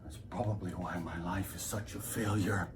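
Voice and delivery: soft, halting, with long pauses; gloomy reminiscence. Acted. Lecturer brooding on failed life and thirteens, hushed gloom. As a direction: sink slowly into hushed despair.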